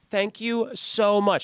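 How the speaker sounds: high, drawn-out gushing thanks; wine-drunk mom. Retold or imitated. Boyfriend's mom thanking the phone's finder, wine-drunk. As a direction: imitate a tipsy mother gushing, slow and high. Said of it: "She was wine drunk".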